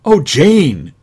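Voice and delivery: put-on high, loud boyish exclamation; boy crying out. Boy answering his sister at the kennel, high loud cry. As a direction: call it like a thrilled kid. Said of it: "cried the boy".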